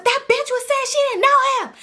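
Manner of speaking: very high-pitched mock scream; retold screaming. Screaming into the phone at cousin, high retold screech. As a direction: screech it without full volume. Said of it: "So I'm screaming in the phone".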